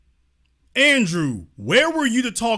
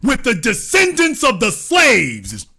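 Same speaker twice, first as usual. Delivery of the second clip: mock rally speech; 3 semitones higher than usual.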